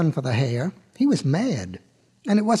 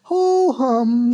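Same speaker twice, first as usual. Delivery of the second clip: high, drawn-out mock yawn; 6 semitones higher, 5 dB louder than usual. A narrator's reading. Hare showing boredom before the race, big acted yawn. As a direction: yawn through the words. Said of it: "he yawned a great big yawn".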